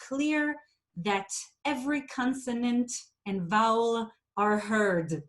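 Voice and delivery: every consonant crisp and overdone; over-articulate each sound to make a point; exaggerated enunciation. Shown as a demonstration. Teacher showing over-clear speech. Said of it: "I made that exaggerated".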